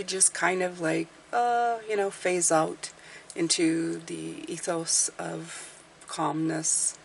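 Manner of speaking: soft voice drifting into slowness; melodious, calming voice. Shown as a demonstration. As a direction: lull them gently toward sleep. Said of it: "I'll try and make a melodious voice".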